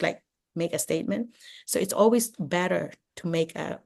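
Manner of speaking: normal talking voice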